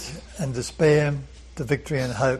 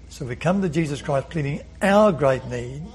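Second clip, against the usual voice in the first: ordinary talk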